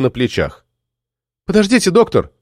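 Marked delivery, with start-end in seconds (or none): 1.5-2.3 put-on mild higher-pitched girl's call; breathless cry. A narrator's reading. Girl running after the doctor on the platform, mild breathless cry. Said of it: "запыхавшись, крикнула она".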